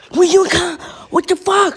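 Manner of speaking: high-pitched mock outburst of confusion; confused, angry friend. Friend woken for a pizza order, mock confused outburst. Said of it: "He's so confused. And he goes".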